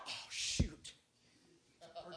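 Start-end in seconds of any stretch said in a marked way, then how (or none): 0.1-0.9 disappointed sigh. Acted.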